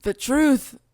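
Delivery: mild witch voice; witch voice try. Shown as a demonstration. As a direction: give it a dark cursing edge. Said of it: "let me try".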